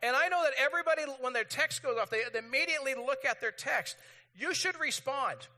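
high, loud, indignant rant; hammed-up exasperation at no reply; irritated rant. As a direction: press each point in mock annoyance. Acting out irritation at unanswered text.

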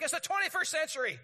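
high, loud, whiny-impatient rant; acted-out indignation at a stalled video; impatient outrage. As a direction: demand it now, hammed up. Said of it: "And you're like".

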